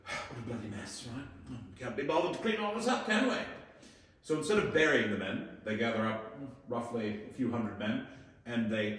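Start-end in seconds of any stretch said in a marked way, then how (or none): 0.0-3.3 lightly done British accent, mock-offhand; a hint of a breezy British officer shrugging it off; imitating a British officer's accent, not too broad. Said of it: "the British, being victorious in typical British fashion, look around at the carnage and go".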